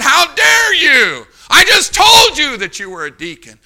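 high, loud, drawn-out indignant shout; hammed-up furious rebuke; imitated angry outrage. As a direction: act out an outraged deacon yelling. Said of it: "he got so angry with me".

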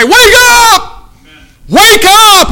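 high, full-force wake-up cry; rousing preacher's shout. Acted. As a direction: holler it down the church aisle. Evangelist's cry down church aisle.

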